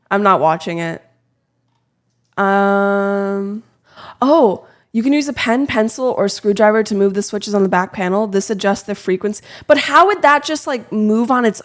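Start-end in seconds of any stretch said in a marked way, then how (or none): none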